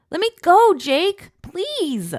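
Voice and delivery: high, loud frantic plea; pleading yell. Acted. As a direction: yell it, half panicked half thrilled. Being carried into river.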